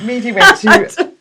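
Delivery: laughing through the words. Real, not acted. Telling the host about spending the money, laughing. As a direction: giggle as you say it.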